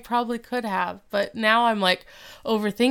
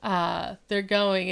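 ordinary talk

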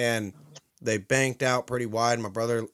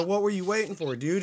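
much higher, incredulous scold; exasperated question; 8 semitones higher than usual. Retold or imitated. Hunter scolding his brother for waiting, higher exasperated question. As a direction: throw up your hands at him.

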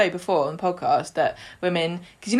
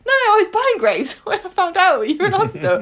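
put-on higher, louder, airy old mum with a laugh; breezy Audrey; 11 semitones higher, 4 dB louder than usual. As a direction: toss it off like nothing's wrong. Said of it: "Audrey's like".

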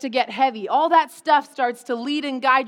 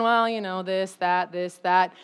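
mildly sulky, dropping lower; mock pouty cynicism; 6 semitones lower than usual. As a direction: imitate a pouting skeptic trailing downward. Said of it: "getting a little pouty".

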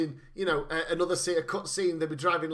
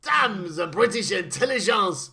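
put-on French accent; 4 dB louder than usual. Villain cursing.

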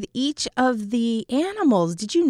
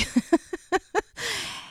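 high breathy giggle at the joke; teasing little laugh; amused laugh; 11 semitones higher than usual. Real, not acted. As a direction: laugh it off lightly. Laughs at dad on bench duty.

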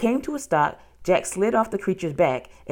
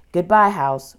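plain reading voice

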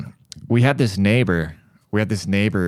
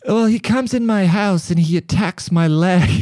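higher, louder imitation of the neighbor; mock complaint; 11 semitones higher, 4 dB louder than usual. One-legged neighbor complaining, mock higher whine. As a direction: whine her complaint back mockingly.

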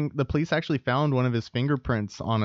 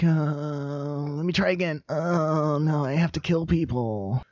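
voicing the killer; 4 semitones higher, slower than usual.

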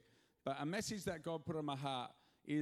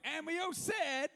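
hammed-up American preacher call; American twang; 12 semitones higher, 6 dB louder than usual. Pastor calls the crowd to answer, mock American twang. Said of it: "Say it with an American twang".

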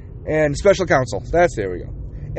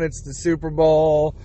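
ordinary talk, nothing marked

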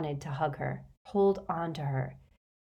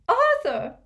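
high, loud tearful cry; sobbing cry; 9 dB louder than usual. A narrator's reading. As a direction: cry out to your husband in tearful relief. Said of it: "sobbed Missus Weasley".

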